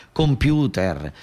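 ordinary talk, nothing marked

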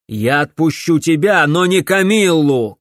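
loud, forceful refusal; father's shout. A narrator's reading. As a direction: shout it as a firm condition. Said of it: "крикнул отец". (требовательный отцовский крик)

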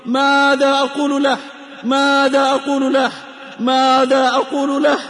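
normal talking voice

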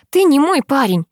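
high-pitched, appalled protest; horrified denial. A narrator's reading. Girl rejecting the driver's boyfriend claim, horrified. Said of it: "она в ужасе округляет глаза".

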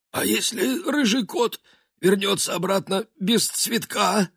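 high wheezy henchman voice. Acted. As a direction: ask like a hoarse eager minion. Trash man asks.

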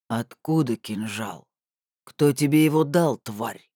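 low, slow opening, then harder; menacing interrogator. A narrator's reading. Thug questioning captive about the dagger, menacing.

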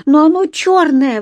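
put-on high, loud child's objection; exclaiming. A narrator's reading. Еля objecting to her brother's yellow paint, exclaiming. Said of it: "воскликнула Еля".

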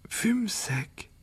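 faint, slurred whisper; dying boy barely gets it out; thick weak whisper. A narrator's reading. Wounded Harry to the phoenix, a thick whisper. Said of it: "dit Harry d'une voix pâteuse".

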